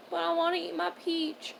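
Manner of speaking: put-on high whiny child voice; whiny little girl. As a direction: whine like a small kid. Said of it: "And she was like".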